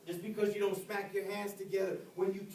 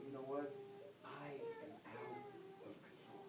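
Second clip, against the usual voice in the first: breaking into tears on the words; soft crying admission of losing control; tearful confession; 10 semitones higher, 17 dB quieter than usual. Real, not acted. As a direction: choke it out, nearly gone.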